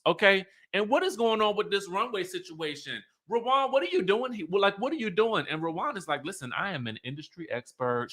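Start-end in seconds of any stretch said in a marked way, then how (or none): 0.7-5.5 high, excited, demanding questions; mock Kenya. Kenya demanding where the models are, high and excited. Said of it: "And so Kenya's like". 6.2-8.1 quietly. Retold or imitated. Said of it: "She is quietly telling her".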